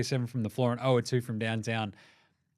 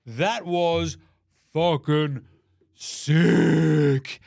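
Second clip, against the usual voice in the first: louder and louder hyped praise; hyped exclamation; 7 dB louder, much slower than usual. Real, not acted. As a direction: build up to a big sick. Hyped about a dunk.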